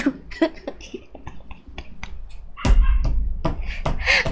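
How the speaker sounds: long high breathy giggle. Real, not acted. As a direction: burst into giggles. Laughing at dog barking.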